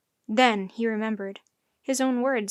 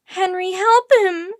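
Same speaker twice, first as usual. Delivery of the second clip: high, loud, drawn-out wail; distraught high-pitched cry; sobbing plea; 8 semitones higher, 10 dB louder, slower than usual. A narrator's reading. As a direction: beg for help through tears. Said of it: "she sobbed".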